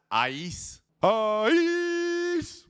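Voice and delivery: sudden high shout of a name; calling out. Real, not acted. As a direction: call the name, then yell it. Calling out to someone.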